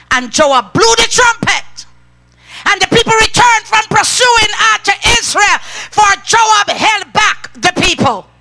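fiery preacher reading aloud; preaching at full force. Acted. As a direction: thunder the verse out to the church. Preacher reading scripture loudly.

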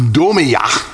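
domiyah with the weight on its final "yah"; demonstrated final-syllable emphasis in domiyah; stress on last syllable. Shown as a demonstration. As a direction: land on the end of domiyah. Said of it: "emphasis on the last syllable".

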